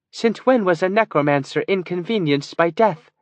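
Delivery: put-on mildly condescending, as to an interrupting child; a touch of an elder hushing a child; quelling a child. A narrator's reading. Kiva hushes Andrus like an interrupting child, mildly condescending. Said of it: "as if quelling a child".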